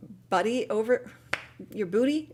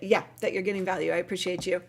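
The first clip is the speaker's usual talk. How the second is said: ordinary talk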